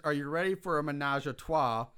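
usual speaking voice

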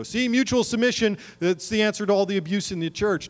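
high, loud mock declaration; mock proclamation. Preacher voicing people who misread the teaching, high mock proclaiming. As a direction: blurt it out as a too-easy answer. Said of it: "we're not coming to this place and go".

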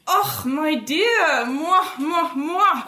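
put-on high, slow, fawning old man; loud, high, slow-drawn greeting from a fat old suitor; pompous old suitor. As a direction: gush over her like a doting widower. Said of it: "balding, big sort of double chins".

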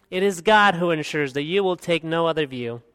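ordinary talk, nothing marked

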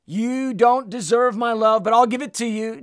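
scolding, grudging pronouncement from above; accusing voice. Acted. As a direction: act out the voice that shames the listener. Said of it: "do you hear another voice".

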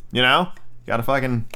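ordinary delivery, nothing marked

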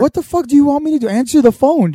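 high, loud yelling retort; exasperated mom. Retold or imitated. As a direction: yell back at a clueless husband. Acting out exasperated mom yelling back.

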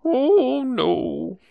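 put-on fearful old man voice. Players echo the fearful old man.

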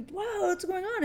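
high-pitched mock shock; upset bewilderment. Retelling the boy's reaction to the reveal, upset and bewildered. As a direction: imitate a stunned, upset reaction. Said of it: "he's really upset".

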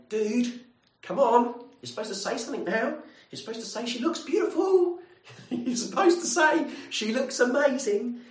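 high, loud urgent nudge with a laugh; nudging best man. Retold or imitated. Bridegroom urging his best man, high and laughing. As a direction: hiss a prompt to your mate.

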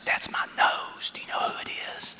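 soft unvoiced whisper; playful hushed question. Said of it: "I whispered".

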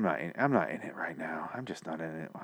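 barely-there real whisper of giving up; defeated whisper. Real, not acted. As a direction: let it die away into a whisper. Host gives up after scrambling.

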